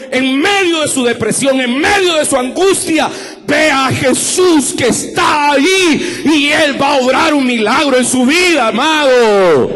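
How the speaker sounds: preacher to congregation, rising to a fervent shout; preaching at full force. Real, not acted. Shout it to the back pew like a revival preacher.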